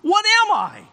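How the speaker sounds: building from stammered admission to high cry; anguished confession. Acted. Simon confessing to Jesus he is unworthy, increasingly anguished. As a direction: rise into pleading unworthiness. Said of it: "He confesses at that moment".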